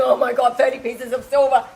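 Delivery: loud, astonished outburst; shocked realization. Retold or imitated. Teller reacting to the thirty dollar price, shocked realization. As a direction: exclaim it as a sudden revelation. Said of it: "I'm going".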